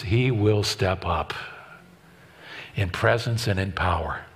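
soft and low, leaning in; hushed emphasis. Real, not acted. Preacher leaning in to the congregation, hushed and low. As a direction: drop to a near hush on power. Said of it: "drops voice for emphasis".

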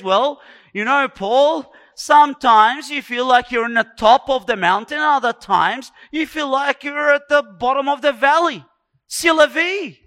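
loud, high mock self-consolation; self-counselling. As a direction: talk yourself round, loudly and emphatically. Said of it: "he begins to counsel himself".

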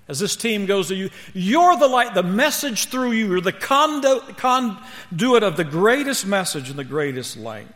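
high, loud, impassioned charge to the team; preach. Real, not acted. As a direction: hit "you're" hard, then ease down at the close. Preaches at full force.

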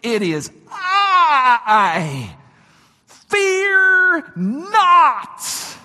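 slow, drawn-out booming shout; forceful proclamation. Shown as a demonstration. Preacher voices Jesus over the storm, loud commanding proclamation. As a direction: cry it out high and loud, stretching each word. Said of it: "I want to say it more like this".

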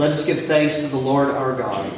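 loud, measured call to the congregation; liturgical call. Real, not acted. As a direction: proclaim it to the hall.

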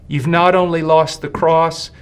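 ordinary talk

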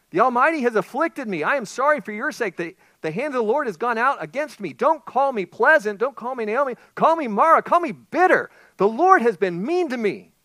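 loud, high bitter outcry; bitter lament. Acted. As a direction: cry out against the Lord. Naomi's bitter lament quoted.

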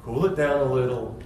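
slow him down gently; calming speed bump. Retold or imitated. Jesus slowing the eager scribe, soft calm restraint. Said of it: "what we need to do in our churches".

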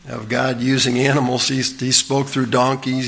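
ordinary talk

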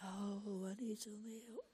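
tiny, barely audible mock plea; mock feeble cry. Preacher showing how one would not cry out, feeble murmur. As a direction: cry out pitifully softly, as a joke. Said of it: "Did you cry out like".

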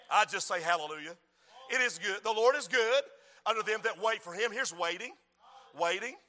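usual speaking voice

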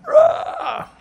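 put-on sudden high, loud baby wail; mock infant scream; baby scream imitation. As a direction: shriek like a crying infant. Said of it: "just started screaming".

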